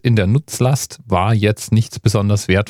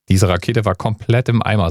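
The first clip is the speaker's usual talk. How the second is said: ordinary talk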